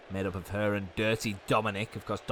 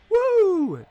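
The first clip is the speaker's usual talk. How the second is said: shrill high-pitched woo shout; high falsetto woo; 10 dB louder than usual. Pretend. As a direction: belt a high woo like the entrance theme. Mimics entrance music woo.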